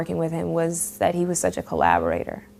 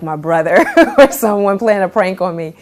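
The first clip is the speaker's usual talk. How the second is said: ordinary talk, nothing marked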